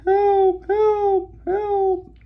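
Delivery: very high, thin cries for help; high faraway help calls; yelling for help. Retold or imitated. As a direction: call out from far off. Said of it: "They're yelling".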